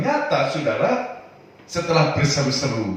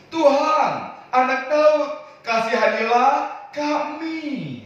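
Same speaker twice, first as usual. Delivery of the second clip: a blind man crying out for mercy; 11 semitones higher, slower than usual. Acted. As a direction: call out desperately for mercy. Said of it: "makin keras berseru".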